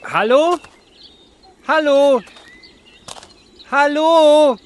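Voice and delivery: high, loud shouts into the dark; searching the street for the beer seller; calling out. Acted. Calling out for the dealer.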